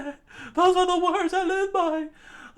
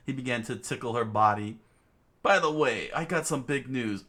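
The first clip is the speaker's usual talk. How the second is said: much lower, soft narrator voice; story narration; 4 dB quieter than usual. A narrator's reading. Narrator tells of tickling before Rio's news, low voice. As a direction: narrate it deep and steady.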